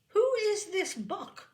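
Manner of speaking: high, loud, slow demand. A narrator's reading. Mother demands to know of Buck, loud and high. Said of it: "my mother demanded".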